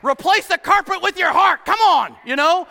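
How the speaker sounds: loud, high preacher's shout; full-force preaching. Real, not acted. Pastor to his church, shouting the point. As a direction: bellow it to the church.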